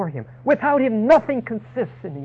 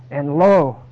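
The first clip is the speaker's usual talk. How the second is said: loud, weighty quote; emphatic scripture declaration; 8 dB louder, much slower than usual. Acted. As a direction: lean hard into it.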